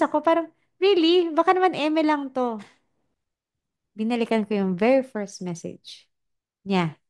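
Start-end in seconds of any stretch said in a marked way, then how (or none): 0.8-2.6 skeptical disbelief. Retold or imitated. Doubtful reaction voiced in story.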